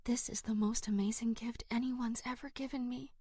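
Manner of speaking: soft unvoiced whisper into a friend's ear. A narrator's reading. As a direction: breathe it secretly so nobody else hears. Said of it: "whispering into her ear".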